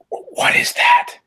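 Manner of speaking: strained whisper shout; whisper yelling. Acted. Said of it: "he whisper shouts over his shoulder".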